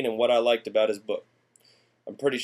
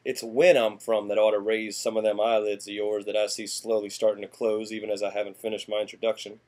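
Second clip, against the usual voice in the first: ordinary delivery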